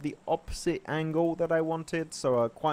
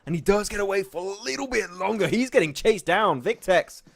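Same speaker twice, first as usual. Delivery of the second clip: rising esports call, building to loud high peaks; live play-by-play; 4 semitones higher, 5 dB louder than usual. Acted. Caster calling a lone player's killing spree live. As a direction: drive the commentary as the fight heats up.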